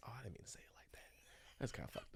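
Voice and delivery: soft unvoiced whisper; whispered to himself. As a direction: whisper the self-correction under your breath. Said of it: "I'm like".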